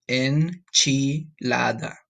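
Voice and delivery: "Enchilada" drawn out very slowly, Spanish vowels; slow Spanish pronunciation. Shown as a demonstration. Teacher repeats "Enchilada" very slowly for students to copy. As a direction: stretch every syllable of "Enchilada". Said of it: "That's how you say it in Spanish".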